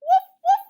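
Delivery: loud, high barking woofs; dog bark. Shown as a demonstration. Showing the class how dogs bark, loud and high. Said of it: "Dogs that bark".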